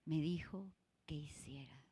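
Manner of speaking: whispered, almost breathless question; soft unvoiced aside; hushed whisper. Real, not acted. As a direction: drop to a whisper for the listeners. Reflective prompt to the congregation.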